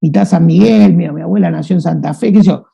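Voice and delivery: ordinary talk, nothing marked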